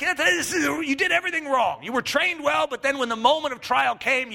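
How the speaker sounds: high, exasperated coach rant; imitated coach's reprimand; scolding coach. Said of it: "coach, you know, speaking to".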